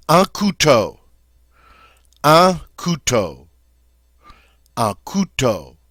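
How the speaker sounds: "couteau" as koo-TOH, a pure O; French pronunciation. Shown as a demonstration. Teacher drilling "un couteau" for students. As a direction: clear French "un couteau", falling. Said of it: "That's how we say knife".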